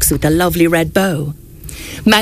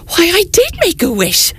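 delight; 9 semitones higher than usual. Acted. Mouse answers Santa delighted.

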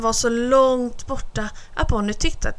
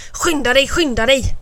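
higher, louder impatient tiger; impatient hiss; 3 semitones higher, 4 dB louder than usual. A narrator's reading. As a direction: hurry him along, snapping. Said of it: "Han fräste bara". (fräsande otålig tiger)

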